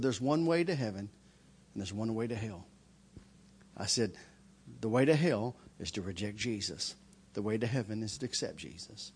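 very soft, low, solemn voice; hushed altar call. Retold or imitated. Preacher telling the men of heaven and hell, hushed and solemn. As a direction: lay it out quietly and gravely.

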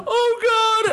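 very high, exaggerated sobbing cry; mock tearful wail. Friend spots his mom's car, hammed-up crying. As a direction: imitate a kid bursting into tears. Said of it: "he bursts into tears".

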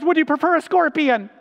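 mock father offer. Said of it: "the father says".

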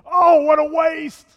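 hammed-up outrage at waste; mock dismay. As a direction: wail it like a scandalized miser. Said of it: "my heart's going".

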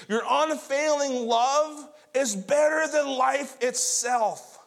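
drawn-out preacher's praise; fervent praise. Acted. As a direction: proclaim the psalm with rising fervor. Declaims David's praise from psalm.

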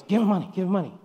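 loud, pushy repeat of the demand; insistent child's demand. Retold or imitated. Young son urging his dad on the train, loud and insistent.

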